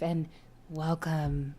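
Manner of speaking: soft, breathy welcome; sweet, eerie host greeting; customer service voice. Acted. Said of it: "your customer service voice".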